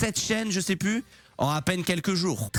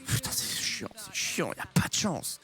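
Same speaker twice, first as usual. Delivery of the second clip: frustrated whisper; 6 dB quieter than usual. Real, not acted. Losing streak frustration.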